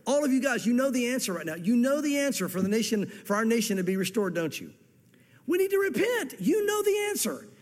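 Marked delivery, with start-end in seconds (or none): 5.5-7.5 high, pressing preacher cry; urgent plea. Real, not acted. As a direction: lift it up and press the point. Preacher pleading with congregation.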